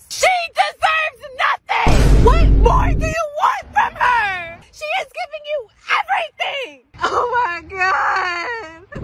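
sobbing, shrieking high; crying shout. Acted. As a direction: wail it out in tears.

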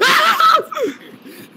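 high shrieking laugh; jump scare laugh. Real, not acted. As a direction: burst into a shrill laugh.

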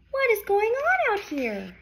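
high surprised farmer's call; startled farmer. Acted. Farmer Fred calling out, half-sung. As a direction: wake up puzzled and shout.

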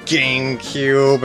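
ordinary talk, nothing marked

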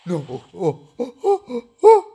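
high-pitched, loud shrieks of disbelief and joy; overjoyed squeals, almost crying; ecstatic screaming. Real, not acted. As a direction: scream like you just won the lottery. Pulls rare Zeon, screams.

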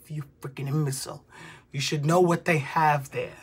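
normal talking voice

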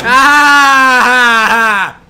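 loud high shriek; sudden startled yell; scream. Real, not acted.

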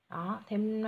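ordinary talk

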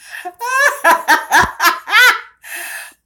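loud high cackling laugh; real loud laughter. Real, not acted. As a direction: burst out laughing at the prank. Laughing after phone prank.

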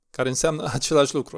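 ordinary talk, nothing marked

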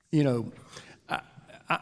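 normal talking voice

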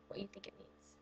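hushed, unvoiced aside; whispered aside. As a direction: whisper it like a secret. Whispered aside about swag.

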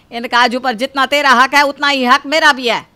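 mother-in-law scolding the daughter-in-law, loud and firm; indignant claim. Acted. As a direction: put your foot down over the cashews. Mother-in-law asserts her claim.

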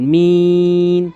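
মিন held for three alif lengths; long drawn vowel. Shown as a demonstration. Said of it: "তিন আলিফ টানবেন".

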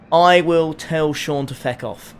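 normal talking voice

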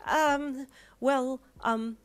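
high, flustered hesitation; stall, trying to regain composure; flustered mum searching for words; fumbling. Acted. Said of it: "your mum fumbled".

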